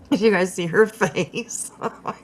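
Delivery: normal talking voice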